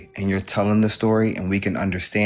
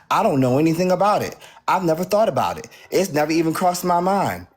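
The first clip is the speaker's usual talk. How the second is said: mocking imitation; 7 semitones higher, faster than usual. Mocking her past claims.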